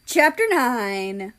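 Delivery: chapter heading. A narrator's reading.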